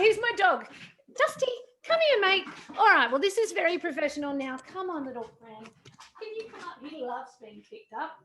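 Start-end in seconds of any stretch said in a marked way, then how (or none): 1.2-2.5 calling the dog. Real, not acted. 4.7-8.2 much softer, gentle coaxing; soft coaxing to dog. Real, not acted. Owner softly coaxing her dog onto her lap, gentle.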